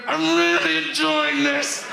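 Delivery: a TV longing to be faced; lonely television. Pretend. TV at the side of the room, pleading wistfully. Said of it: "television will be on the side of the room going".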